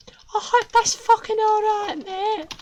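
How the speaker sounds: very high chirpy voice on "I hope that's fucking all right, mate"; high cartoon villain voice; squeaky villain. Squeaky voice repeats line.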